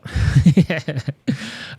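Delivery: loud, high burst of laughter. Real, not acted. Host laughing at his own accent anecdote, loud laugh.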